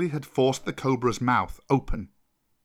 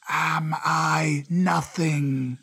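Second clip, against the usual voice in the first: slow, drawn-out cobra voice; very slow, affronted serpent; 3 semitones higher, much slower than usual. Acted. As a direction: stretch each word out like an old serpent. Cobra speaks from the vault.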